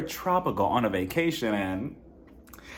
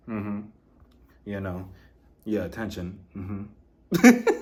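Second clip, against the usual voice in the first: dismissive Sean impression; 6 semitones lower, much slower than usual. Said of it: "looking at her like".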